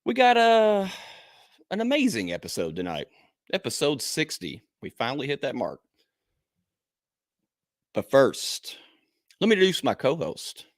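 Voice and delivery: big, punchy podcast host opening; announcer intro. Acted. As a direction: hype up the co-host like a ring announcer, with dramatic pauses. Hypes the show and co-host.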